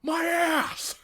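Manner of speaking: high, loud comic wail; mock pained cry. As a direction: wail as if hurt from a fall. Acted pain after falling.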